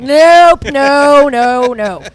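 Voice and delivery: loud, drawn-out mock refusal, starting high; emphatic refusal. Singer retelling how she shot down the song idea, emphatic refusal. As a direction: belt out a retold no, stepping down in pitch.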